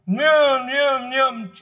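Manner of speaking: droning sputter of an engine turning over; cold engine imitation. Preacher likening people to cold engines, imitated engine noise. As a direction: imitate an old oil engine struggling to start. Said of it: "like an oil engine that's cold".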